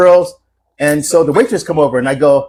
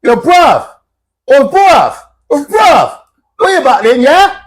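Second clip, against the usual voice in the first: high, loud mock English street challenge; imitated English accent; 8 semitones higher, 4 dB louder, slower than usual. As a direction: call out bruv in a hammed-up English accent. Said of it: "I love your accent".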